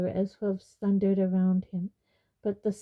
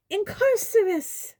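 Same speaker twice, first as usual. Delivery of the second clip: high-pitched shrieked incantation; screamed spell; 13 semitones higher, 4 dB louder than usual. A narrator's reading. Umbridge casting at centaur, high scream. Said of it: "pointed her wand at Megorian and screamed".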